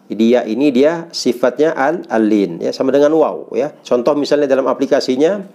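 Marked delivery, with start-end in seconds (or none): none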